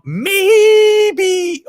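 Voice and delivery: high, loud, stretched-out maybe; long high-pitched teasing maybe. Pretend. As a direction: sing it up high and hold it. Mock suggestion about his cheese.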